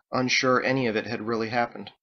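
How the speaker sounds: ordinary delivery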